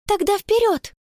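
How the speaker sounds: high-pitched rallying cry. A narrator's reading. Конни rallying her friends to run for help, crying out. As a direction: urge everyone on, voice lifted high. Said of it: "крикнула Конни".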